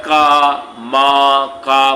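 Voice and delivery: long, loud AA in का, मा, का; drawn-out final syllables. Shown as a demonstration. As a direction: stretch the आ in each of का, मा, का. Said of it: "इन सब के अंत में क्या आ रहा है". (का, मा, का में लंबा आ खींचकर)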